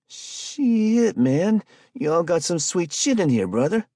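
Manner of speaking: impressed street hustler. A narrator's reading. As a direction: gawk at the loot, excited. Spike voiced, impressed.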